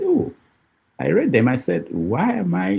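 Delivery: usual speaking voice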